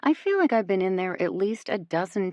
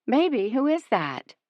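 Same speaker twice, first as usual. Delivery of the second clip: higher, a woman's sleepy call; woman calling from bed; 7 semitones higher than usual. A narrator's reading. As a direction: call out from the next room. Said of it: "a woman's voice calls out".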